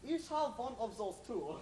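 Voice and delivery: put-on stranger speaking English in a thick German accent. Mysterious stranger greets the heroes in a German accent. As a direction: lean into the German vowels and "Ich". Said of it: "But you're speaking with a German accent".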